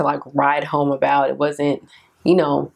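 normal talking voice

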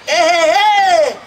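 drawn-out high, loud heys; hey-hey hype call, stretched out; demo intro call. Shown as a demonstration. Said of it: "For example, somebody say".

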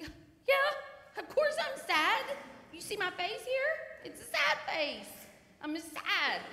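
high, loud mock-indignant wail; hammed-up sad self snapping back; exasperated retort. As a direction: play up the sadness, loud and high. Acts out her younger sad self.

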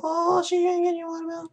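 very high, odd falsetto retort; high falsetto mock retort; mock reply voice. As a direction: answer in a silly high put-on voice. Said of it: "I'm like".

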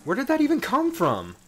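high, startled swearing; alarmed. Real, not acted. Gamer startled by spiders, high alarmed swearing. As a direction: yelp in shock at the sudden spiders. Spider attack panic in game.

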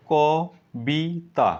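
ক, বি, তা said as separate beats; syllable by syllable. Shown as a demonstration. Teacher splitting কবিতা into syllables. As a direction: break কবিতা into ক, বি, তা. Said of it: "কবিতাকে তোমরা ভাঙো".

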